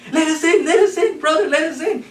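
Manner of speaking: the fleeing pigs hammering at the door; panicked pleading. Acted. Pigs fleeing beg brother.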